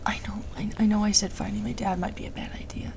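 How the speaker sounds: soft whisper, barely voiced; whispered confession. Real, not acted. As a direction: hush it like a guilty admission. Confessing her doubt quietly.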